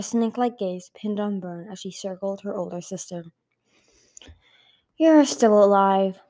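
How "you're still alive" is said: drawn-out, forceful villain's remark; louder greeting. A narrator's reading. Blister greeting her surviving sister, loud and drawn-out. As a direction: say it slowly and loudly.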